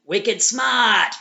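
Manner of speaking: put-on broad, drawn-out Boston accent. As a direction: lean hard into a slow Boston accent. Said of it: "somebody is from Boston when they describe".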